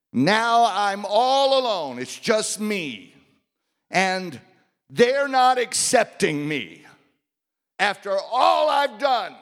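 a worn-out prophet pleading, high and loud; weary prophet. Acted. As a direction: cry it out to God, exhausted and hurt. Elijah complains to God.

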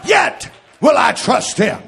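shouted, ringing sermon cadence; rousing pulpit shout over music; full-force preaching. Acted. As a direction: preach it out to the whole church.

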